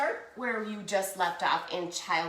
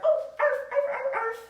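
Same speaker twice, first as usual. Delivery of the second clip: high-pitched little yapping; squeaky imitation dog bark; puppy arfs; faster than usual. Shown as a demonstration. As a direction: yap like a small puppy. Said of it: "what kind of sounds does your puppy make".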